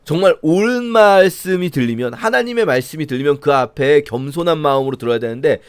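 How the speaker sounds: ordinary talk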